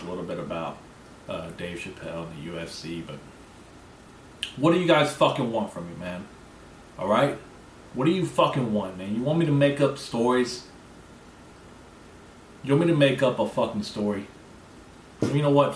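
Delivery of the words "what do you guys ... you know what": louder, higher, exasperated outburst; exasperated rant. Real, not acted. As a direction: vent at the listeners who want more. Ranting angrily at his listeners.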